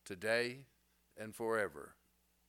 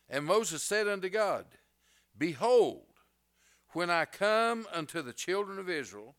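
loud, high, ringing scripture reading; preaching at full force; 6 semitones higher, 6 dB louder than usual. Acted. Preacher to congregation, reading Moses' plea to God, booming. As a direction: thunder the verses out to the whole church.

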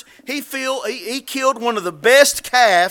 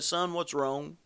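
soft and low, not raising his voice; gentle, quiet; 4 semitones lower, 13 dB quieter, slower than usual. Retold or imitated. Father quietly asks his angry eldest son, softly. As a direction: ask gently like a worried father. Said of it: "not raising his voice".

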